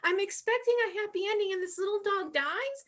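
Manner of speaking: high, loud mock outrage; upset, indignant outburst. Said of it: "I was so upset".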